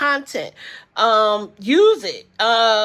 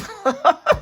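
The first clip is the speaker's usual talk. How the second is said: laugh; 7 semitones higher, 4 dB louder than usual. Real, not acted. Laughing at herself asleep.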